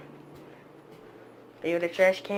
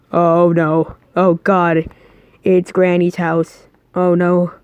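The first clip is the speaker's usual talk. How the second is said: usual speaking voice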